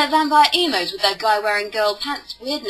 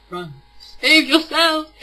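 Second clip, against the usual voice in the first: mock warning; 5 semitones higher, 4 dB louder, slower than usual.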